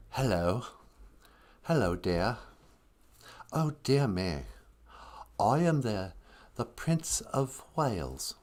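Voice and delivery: imitated soft, effeminate English accent; whispery English accent. Host imitating Prince Henry introducing himself, whispery English accent. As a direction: do a hushed, dainty English prince. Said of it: "A whispery English accent".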